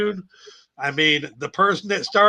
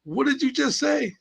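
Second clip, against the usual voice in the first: higher, softer questioning voice; lightly voiced indignant grandfather; retold granddad; 6 semitones higher, 4 dB quieter than usual. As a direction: imitate a puzzled elder's challenge. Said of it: "He said".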